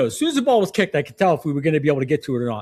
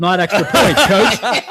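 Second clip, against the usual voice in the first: laughing; 5 semitones higher, 4 dB louder, slower than usual. Real, not acted. Laughing at kid's answer.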